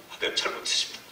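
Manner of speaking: soft, breathless whispered confession; whispered repentance. Retold or imitated. Pastor confessing to God after weeping, a soft whisper. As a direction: whisper it to God, spent from crying. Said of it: "막 우는 거예요".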